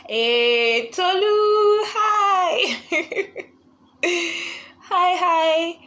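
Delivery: high, loud, laughing hello; light up as she joins; excited greeting. Real, not acted. Host squeals hello to her sister joining, delighted laugh.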